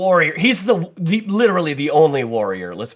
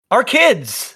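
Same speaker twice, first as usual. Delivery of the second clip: excited announcement; 9 semitones higher, 3 dB louder than usual. Acted. Grand introduction of guests.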